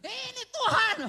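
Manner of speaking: high, loud rebuke; hammed-up outraged God; mock indignant Lord. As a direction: snap back who you are talking to.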